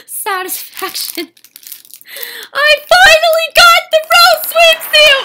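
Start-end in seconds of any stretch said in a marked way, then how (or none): 2.5-5.3 shrieking, much higher and louder; ecstatic squeal. Real, not acted. Collector finally unboxing the doll swimsuit, shrieking with joy. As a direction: scream it out with joy. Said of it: "I'm, like, getting really emotional".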